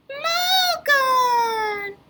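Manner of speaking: high, slow, squeaky puppet greeting; a cheerful little puppet says hello; high cartoon puppet voice calling out; puppet Leo voice. Puppet corrected.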